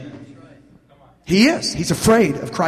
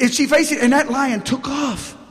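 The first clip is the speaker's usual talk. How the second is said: normal talking voice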